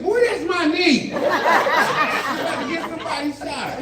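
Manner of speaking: high, loud, slow, laughing; loud laughing confession; laughing punchline. Real, not acted. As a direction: laugh through the reveal. Laughing punchline about his knee.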